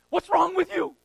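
high, loud exasperated cry; a frazzled mother yelling at her husband; mock exasperated shout. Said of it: "They grab their husbands and say".

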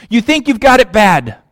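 ordinary talk, nothing marked

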